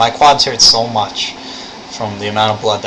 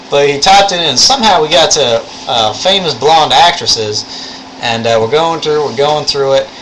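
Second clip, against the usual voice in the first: raised, animated Southern storytelling voice; thick Southern accent; 4 semitones higher, 3 dB louder than usual. Retold or imitated. Showing viewers how the lab instructor talks, thick Southern accent. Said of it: "only with a thick Southern accent".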